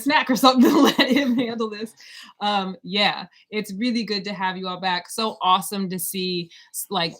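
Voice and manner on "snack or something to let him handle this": higher, louder, breaking into laughter; joking, laughing. Real, not acted. Co-host teasing that he can handle this, laughing. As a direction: laugh through a playful tease.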